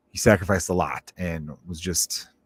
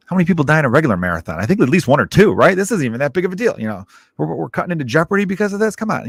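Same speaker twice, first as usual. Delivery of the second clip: higher, fast mock outrage; incredulous rant; 8 semitones higher, 4 dB louder, faster than usual. Dad complaining the bombing interrupts Jeopardy, exasperated fast rant. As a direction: rattle off the gripe, disbelieving and exasperated. And then he was like.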